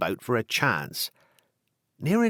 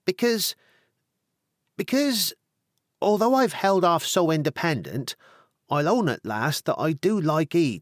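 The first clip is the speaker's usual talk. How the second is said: put-on higher, coaxing young woman owning up to love; coy country girl; 7 semitones higher than usual. A country girl confessing her feelings to Tony, coyly. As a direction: coax him sweetly, half-shy. Hannah voiced by narrator.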